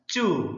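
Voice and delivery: चू with a held oo; long vowel. Shown as a demonstration. Teacher sounds out a spelled syllable, long vowel. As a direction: draw out the vowel of चू. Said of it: "उच्चारण थोड़ा सा लंबा होता है".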